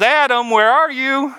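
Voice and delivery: high, far-off call of God searching. Acted.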